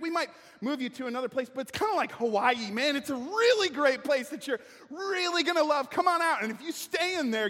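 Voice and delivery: loud, high, wheedling enemy envoy; brash sweet-talking invader; mocking enemy envoy. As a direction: sell the lie like a pushy salesman. Preacher voicing the Assyrian envoy.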